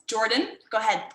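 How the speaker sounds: ordinary talk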